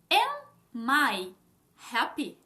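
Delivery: slow, each word set apart; word-by-word demo. Shown as a demonstration. Teacher building an English question for learners, word by word. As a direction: lay out the question one word at a time.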